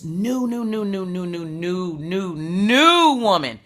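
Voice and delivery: rising, stretched repetition of "new"; growing wonder; building excitement. Real, not acted.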